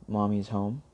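ordinary delivery, nothing marked

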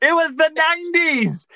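high, loud, drawn-out outburst; exclaiming objection. Real, not acted. Co-host objecting to a joke about beige walls, exclaiming loudly. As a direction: shout it out in high-pitched protest.

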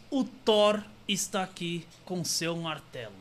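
measured, deliberate hypnotist patter; commanding hypnotist, phrase by phrase; stage hypnosis. Real, not acted. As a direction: plant the suggestion slowly with weighty pauses.

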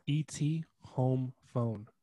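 slow, word-by-word "home phone"; slow quote. Retold or imitated. Correcting the famous line, slow and deliberate. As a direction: spell out the swapped order slowly. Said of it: "he says".

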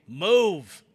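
imitating a wrestler ordering a cameraman to move; imitating Drew. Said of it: "you hear Drew McIntyre say".

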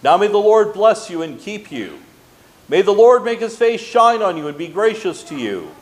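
ordinary talk, nothing marked

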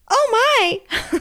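very high shocked little voice; imitating gasping toddler. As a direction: gasp like a scared small child. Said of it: "and he's like".

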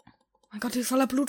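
ordinary talk

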